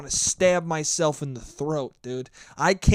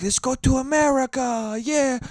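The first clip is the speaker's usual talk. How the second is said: loud, high mock Italian cheer; hammed-up Italian accent; 8 semitones higher, 5 dB louder than usual. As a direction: shout it as an excited Italian family. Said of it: "I can't even do a fucking Italian accent anymore".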